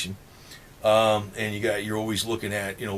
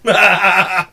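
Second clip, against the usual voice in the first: laughing; 9 semitones higher, 11 dB louder than usual. Real, not acted. Laughs at Putin joke.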